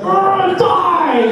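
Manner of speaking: high, strained voicing of an imagined curse; mock menacing thought. Homeless woman imagining he wished her dead, mock voice. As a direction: squeak it out like a cartoon villain. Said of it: "thinking I was like".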